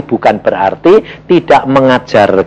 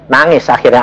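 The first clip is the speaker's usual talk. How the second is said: ordinary talk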